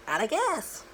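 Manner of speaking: high, cartoony out of gas; cartoon voice. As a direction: play it like a Bugs Bunny gag. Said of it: "like that Bugs Bunny cartoon".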